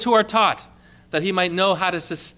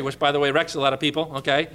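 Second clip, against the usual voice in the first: usual speaking voice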